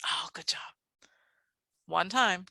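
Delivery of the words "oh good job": hushed, voiceless whisper; very soft whispered teacher voice. Shown as a demonstration. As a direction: whisper it to the class. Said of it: "Can you whisper that sound".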